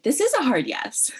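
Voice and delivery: high, loud, emphatic promise; retold insistence. Editor assuring the poet of commitment, retold insistence.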